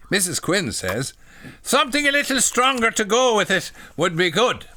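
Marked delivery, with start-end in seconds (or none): 1.6-4.7 high, loud mock Irish accent; badly faked Irish lady. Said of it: "you can't do an Irish accent".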